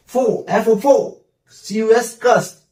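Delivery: फो and कस drawn out slowly from their letters; sounding out syllables. Shown as a demonstration. Teacher breaks focus into sounds for students. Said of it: "फोकस".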